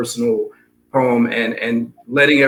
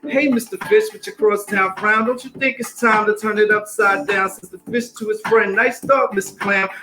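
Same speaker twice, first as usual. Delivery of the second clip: lively singsong rhyme over a beat; rhythmic story reading; 4 semitones higher than usual. Acted. Reading Pout Pout Fish to the audience, rhythmic story reading. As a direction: read it bouncy, riding the rhythm.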